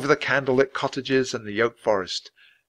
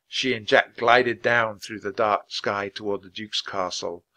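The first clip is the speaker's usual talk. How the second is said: usual speaking voice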